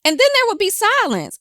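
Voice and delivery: normal talking voice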